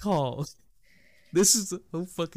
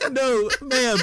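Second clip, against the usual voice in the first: laughing; 7 semitones higher than usual. Retold or imitated. Quoting self mid-laugh.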